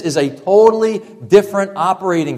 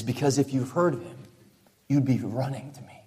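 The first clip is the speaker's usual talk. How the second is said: hushed, intimate plea, dropping almost to a whisper; soft voiced Jesus; 4 semitones lower, 12 dB quieter than usual. Jesus quietly pleading with religious people, hushed. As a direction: draw them in quietly, as if confiding.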